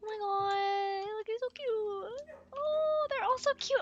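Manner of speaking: soft high coo, drawn out; cooing at puppies. Real, not acted. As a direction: melt over the cute dogs.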